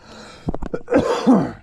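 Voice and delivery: loud, high throat clear; hard throat clearing. Real, not acted. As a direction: hack the bug out. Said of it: "that bug in my mouth is not good".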